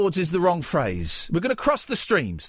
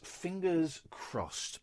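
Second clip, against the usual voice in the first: slow hushed hope; 10 dB quieter, much slower than usual. Real, not acted.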